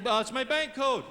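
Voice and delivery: ordinary delivery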